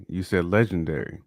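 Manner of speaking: ordinary talk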